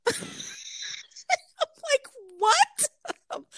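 high-pitched incredulous laugh; disbelieving, laughing outburst. Real, not acted. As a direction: squeak it out through real laughter. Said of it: "Sorry, I'm laughing".

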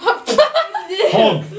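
"Hog" said high in a mock Scottish accent. Shown as a demonstration. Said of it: "How does the Scottish person say hog".

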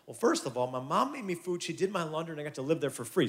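usual speaking voice

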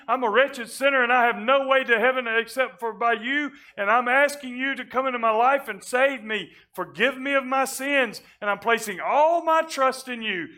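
loud, high, urgent preacher's build; preaching at full force. Acted. Preacher crying out the sinner's prayer to the congregation, loud and high. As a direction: plead with the people to surrender everything.

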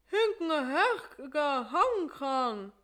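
high, slow, garbled through an open mouth; mouth wide open. Acted. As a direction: try to talk while the dentist holds your mouth open. Patient talking with mouth open.

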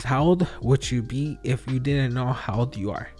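ordinary talk, nothing marked

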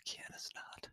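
a soft unvoiced whisper; whispered aside to a friend. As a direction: breathe the name like a secret. Quoting a thought, whispered.

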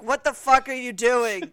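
hammed-up indignation; outraged scolding. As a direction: demand it in exasperated disbelief. Acting Destiny's outraged scolding.

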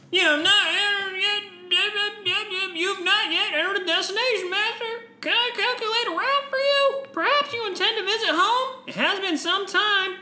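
high, sing-song polite droid. Acted. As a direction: chirp it like a helpful robot. Voicing the ship's droid.

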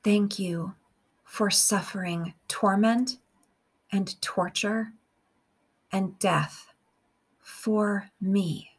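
slow, measured meditation with long pauses; guided prayer. Real, not acted. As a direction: pray phrase by phrase, unhurried, leaving room to repeat.

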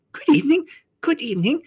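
high-pitched faun character voice; flustered faun. A narrator's reading. Faun returning a girl's greeting, high and fussy.

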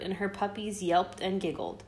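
ordinary delivery, nothing marked

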